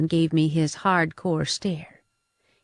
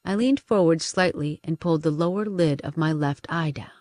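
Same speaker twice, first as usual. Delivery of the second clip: plain reading voice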